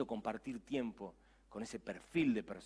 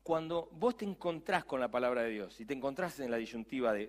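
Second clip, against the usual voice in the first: ordinary talk, nothing marked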